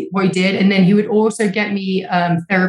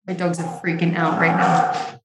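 normal talking voice

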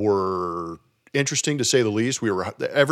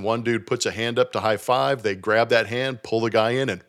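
normal talking voice

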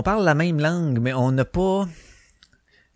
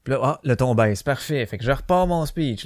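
ordinary talk, nothing marked

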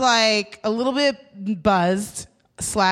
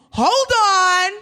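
sassy insistence; 7 semitones higher than usual. Retold or imitated. Rider insisting to the driver, mildly indignant sass. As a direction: stand on your rights, half laughing. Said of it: "still a little sassy".

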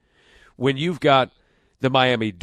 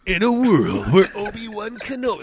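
higher, louder trailer announcer; movie trailer voice; 7 semitones higher, 4 dB louder than usual. Retold or imitated. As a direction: parody the epic trailer opener. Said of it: "acting like you're giving movie previews".

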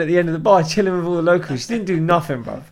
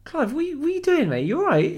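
much higher-pitched, wary check-in; concerned placating; 12 semitones higher than usual. Retold or imitated. Barman checking on the drunk regular gripping his wrist, high and placating.

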